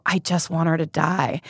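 normal talking voice

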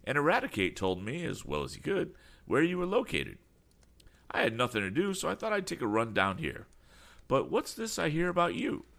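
high-pitched effusive greeting; glad, excitable eccentric. Acted. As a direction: greet a friend in a fussy high voice. Damon chatters to Tom.

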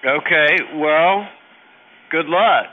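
loud and flustered, backing away; nervous loud brush-off. Real, not acted. Flustered by lewd caller.

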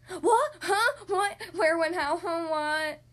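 loud, flustered startled elephant; startled awake. Acted. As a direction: jump out of sleep, confused. Gerald jolted awake.

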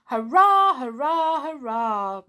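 loud high hurrahs fading down; cheering. Acted. Pixie cheering that it's their birthday, loud then fading.

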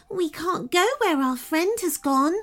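high little fairy voice, downcast; 低落的小仙子声音; sad fairy. A narrator's reading. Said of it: "said the second fairy".

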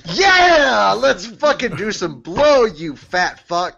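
loud, high party whoop; excited yell. Pretend. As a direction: hype him up for a wild party. Party cheer to resurrected Franklin.

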